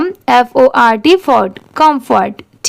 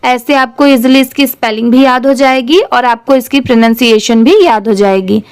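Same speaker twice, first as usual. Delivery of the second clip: usual speaking voice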